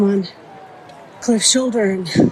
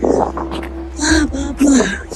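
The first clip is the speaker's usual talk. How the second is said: imitated fury, drawn out and higher; slow, raised-pitch mock rage; furious man; 6 semitones higher, much slower than usual. Man hunting the girl at the club, furious. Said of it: "furious. He was so furious".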